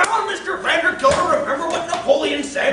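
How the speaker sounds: high, loud plea to calm down; urgent restraining. Acted. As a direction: shout over the master's fury. Servant restraining furious master, more urgently.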